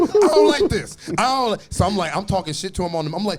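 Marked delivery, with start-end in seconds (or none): 0.0-1.6 high, loud distressed complaint; mock distress. Player being hunted down in the game, mock distress. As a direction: protest in a raised, hammed-up voice.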